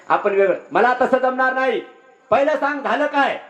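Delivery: high, insistent protest; indignant refusal. Acted. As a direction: cut off your brother and demand the reason. Elder brother refuses, demands reason.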